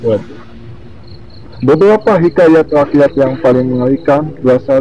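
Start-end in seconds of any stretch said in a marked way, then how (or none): none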